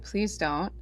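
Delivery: ordinary talk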